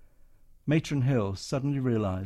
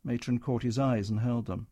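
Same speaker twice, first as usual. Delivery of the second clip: ordinary delivery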